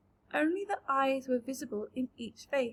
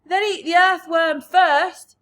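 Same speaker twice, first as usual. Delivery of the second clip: high, slow, loud cry; shout; 6 semitones higher, 14 dB louder than usual. A narrator's reading. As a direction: shout it out selfishly. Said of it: "shouted the centipede".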